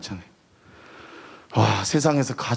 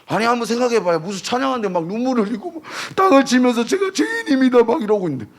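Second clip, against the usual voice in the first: mocking scoff; 7 semitones higher, 3 dB louder than usual. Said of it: "제가 이랬어요".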